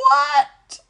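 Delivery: sudden high loud cry of shock; high startled yelp of disbelief; shocked shout. Retold or imitated. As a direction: blurt it out in alarm. Shelley's shocked reaction.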